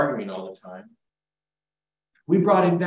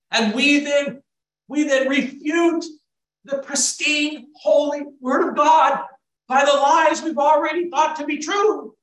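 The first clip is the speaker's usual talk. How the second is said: impassioned preaching; 10 semitones higher, 6 dB louder than usual. Real, not acted. Preacher decrying lies that refute God's Word, impassioned shout. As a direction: build to a pulpit shout.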